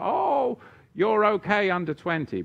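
high, loud mimicking of a glib excuse; mock reassurance. Mimicking people who excuse the young.

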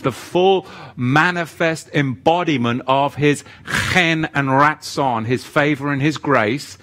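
loud, high, fervent preaching; preaching at full force. Acted. Preacher to congregation, fervent shouted sermon. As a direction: hammer the scripture home to the congregation.

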